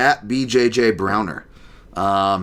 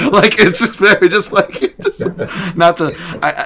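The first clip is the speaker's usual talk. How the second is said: laughing; 9 semitones higher, 7 dB louder than usual. Real, not acted. Laughing after the impression.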